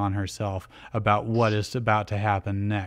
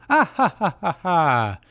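high, loud ha-has falling away; acted gloating cackle; villainous cackle; 6 semitones higher, 5 dB louder than usual. A narrator's reading. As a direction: throw out a staged evil cackle. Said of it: "She cackles more".

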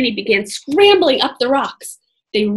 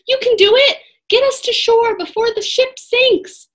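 high, loud urging; urgent encouragement; 11 semitones higher, 4 dB louder than usual. A narrator's reading. Annie urging Jack to reach shore. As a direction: push him to keep going. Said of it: "said Annie".

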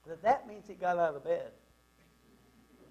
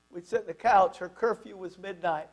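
ordinary delivery

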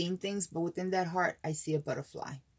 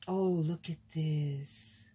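usual speaking voice

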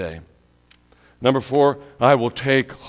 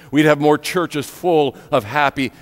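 ordinary talk, nothing marked

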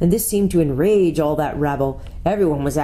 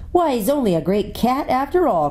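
a higher mocking creature voice; jeering enemy; 8 semitones higher than usual. A narrator's reading. Enemy mocking the shorn lion, sneering. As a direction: sneer it out like a brute in the crowd. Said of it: "cried one".

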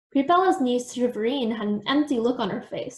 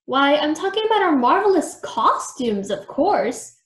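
excited; 4 semitones higher, 4 dB louder than usual. A narrator's reading. Creepella's grand announcement.

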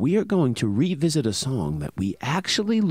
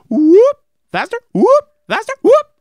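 quick rising whoops, faster each time; fast siren whoops; 12 dB louder than usual. Shown as a demonstration. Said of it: "Little faster".

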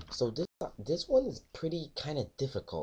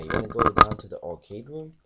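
suddenly much louder, sure of it; 11 dB louder than usual. Real, not acted. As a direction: belt the answer out. Repeats answer much louder.

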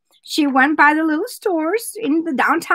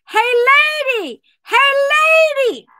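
squawky bird catcall in a joke; talking parakeet; 10 semitones higher, 3 dB louder, much faster than usual. Acted. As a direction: call out like a pet bird. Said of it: "the bird said".

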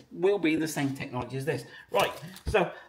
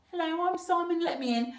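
high little key voice; small, high pretend voice; bleating key; 10 semitones higher than usual. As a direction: bleat it out like a tiny pestering gadget. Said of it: "it keep bleating on".

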